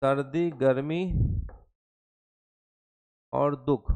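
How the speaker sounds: low, slow dictation in separated chunks. Real, not acted. Dictating slowly while writing it out.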